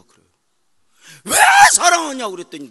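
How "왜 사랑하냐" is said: high, loud sobbing protest; crying shout. Retold or imitated. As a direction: wail it at God. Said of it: "하나님 앞에 울었더니". (하나님께 울며 외치는 항의)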